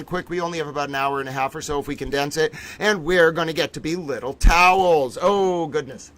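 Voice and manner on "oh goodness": ordinary talk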